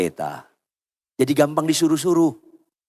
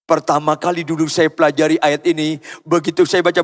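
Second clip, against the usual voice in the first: ordinary talk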